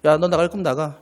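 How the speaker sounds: lightly voiced stern parent; a hint of a stern father daring the child; stern bluff. Father daring his teen son to leave, mild stern bluff. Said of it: "근엄한 표정으로".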